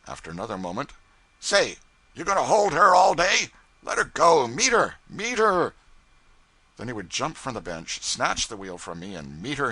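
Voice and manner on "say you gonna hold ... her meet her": high, loud barked commands; yelling orders. A narrator's reading. Pilot barking helm orders at the cub, high and loud. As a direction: bark each order hard.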